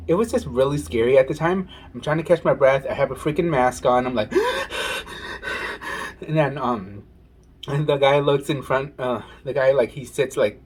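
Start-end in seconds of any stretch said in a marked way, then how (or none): 4.4-6.2 a long high breathless gasp; frightened gasp. Retold or imitated. Scared flyer catching her breath, long high gasp. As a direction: gasp for air in fear.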